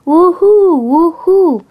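high, loud, drawn-out woohoo; whooping. A narrator's reading. Blue calling from afar, whooping loudly. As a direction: whoop it out with swooping pitch. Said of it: "Yellow heard Blue going".

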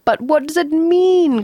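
high, exasperated cry. A narrator's reading. Baffled man to his friend, crying out. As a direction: cry out for an explanation. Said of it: "cried Arthur".

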